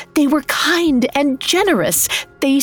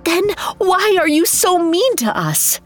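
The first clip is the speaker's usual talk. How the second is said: very high-pitched, pleading outburst; anguished disbelief; 11 semitones higher than usual. A narrator's reading. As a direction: demand an answer as a hurt young woman. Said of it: "pounded the ground in disbelief".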